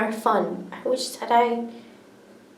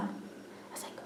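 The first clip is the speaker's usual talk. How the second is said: usual speaking voice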